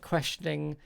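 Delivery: ordinary talk, nothing marked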